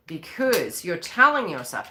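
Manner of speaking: ordinary delivery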